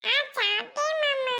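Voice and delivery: put-on high little child voice; reply in a tiny child's voice; small child. Child telling uncle they have eaten, small child voice.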